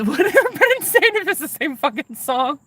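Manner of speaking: high, laughing question. Real, not acted. To viewers at the absurd idea, high laughter. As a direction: laugh through it in disbelief.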